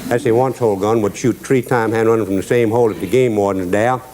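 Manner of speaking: usual speaking voice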